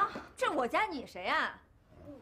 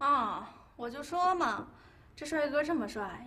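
ordinary talk, nothing marked

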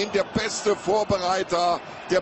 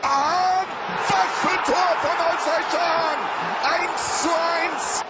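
ecstatic goal call; 10 semitones higher than usual. Real, not acted. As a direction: scream the goal call in delight. Goal replay, commentator erupts.

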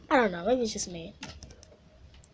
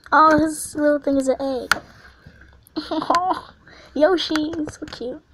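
normal talking voice

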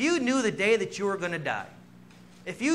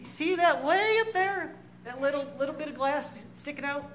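tour guide; 7 semitones higher than usual. Retold or imitated. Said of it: "the tour guide".

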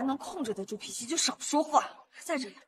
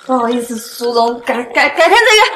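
loud high flustered apology; 3 semitones higher, 18 dB louder than usual. Acted. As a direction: stammer out a hurried goodbye. Wife flustered, dragged away.

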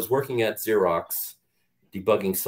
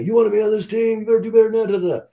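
loud, high imitated coach screaming; coaches yelling; 7 dB louder, much faster than usual. Coaches screaming at the rookie after a bad play, loud and high. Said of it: "screaming at me and yelling at me".

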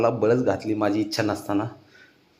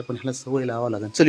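usual speaking voice

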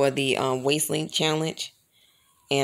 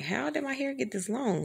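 amazed; 9 semitones higher, 6 dB quieter than usual. Retold or imitated. Surprised at her own hair length.

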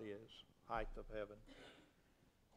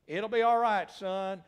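loud, high fatherly assurance; forceful reassurance; 7 semitones higher, 13 dB louder, slower than usual. Acted. God answering his son on the cross, forceful reassurance. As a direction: call the comfort out over the anguish.

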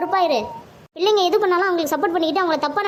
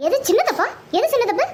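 higher, indignant echoed question; outraged disbelief; 5 semitones higher than usual. Acted. Mother challenging her son's small-mistake excuse, indignant. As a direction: fling the words back angrily.

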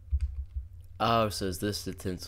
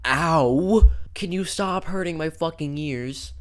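annoyed yelp; 8 semitones higher, 7 dB louder, slower than usual. Real, not acted. Game noise hurts his ears.

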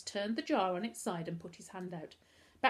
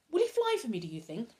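high, louder, eager question; excited; 4 semitones higher, 6 dB louder than usual. A narrator's reading. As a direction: ask eagerly for a treat.

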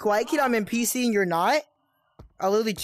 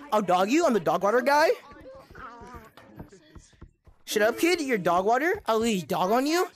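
put-on cocky trash-talking kid, a bit higher, taunting; toxic gamer kid; 4 semitones higher than usual. Gamer taunting lobby players as smug bully kid. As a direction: sneer at the other players like a smug little bully.

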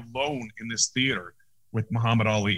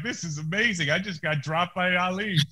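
higher, awed, a little breathless; lightly voiced starstruck fan; giddy disbelief; 6 semitones higher than usual. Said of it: "I'm like".

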